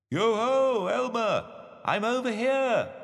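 high, sing-out call; hidden voice calling. A narrator's reading. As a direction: call out high from a hiding place. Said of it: "called the voice".

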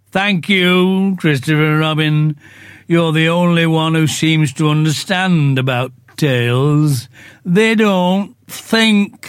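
slow, mournful donkey voice complaining; gloomy Eeyore. Acted. Eeyore grumbles to the others about his cold tail, slow and mournful. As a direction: drag each word out like a sad old donkey.

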